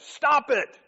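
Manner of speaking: a high, loud shout that makes her jump; sudden yell. Retold or imitated. Counselor yells at the young lady client. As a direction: bark it out to shock her. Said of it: "then he yells it and scares the girl".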